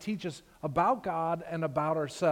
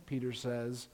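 usual speaking voice

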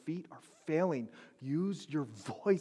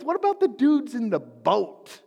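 mock indignation; 8 semitones higher, 14 dB louder than usual.

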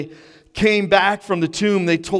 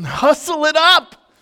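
slow, much higher, drawn-out hurry-up; frustrated; 10 semitones higher, 5 dB louder, slower than usual. Retold or imitated. Cold owner hurrying his dog, slow exasperated plea. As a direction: stretch it out, fed up and laughing. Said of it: "my frustration".

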